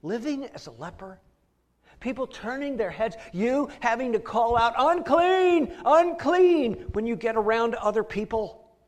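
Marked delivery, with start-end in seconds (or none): 4.8-6.7 high loud cry of unclean; calling out. Retold or imitated. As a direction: call it out to warn people off. Leper calling out warning.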